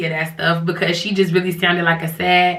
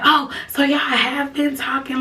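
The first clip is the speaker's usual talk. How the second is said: higher, slow, mocking sass; with attitude; 7 semitones higher than usual. Girlfriend catching on and getting sassy, mock attitude. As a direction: give her line a little rah-rah. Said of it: "getting a little bit of rah-rah to her".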